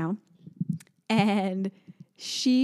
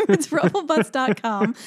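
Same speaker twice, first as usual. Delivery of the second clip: laughing; 7 semitones higher, 5 dB louder than usual. Real, not acted. Laughing at brand name.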